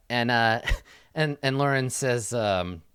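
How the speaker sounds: ordinary delivery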